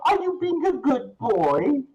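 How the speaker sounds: put-on very high, loud baby-talk lady; cooing lady. Large lady petting the cat, very high baby talk. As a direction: gush at the kitty in a sing-song. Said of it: "she tries to pet you".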